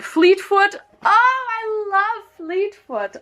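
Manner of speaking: high, slow cooing over the dog; gushing. Real, not acted. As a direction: coo like over a puppy.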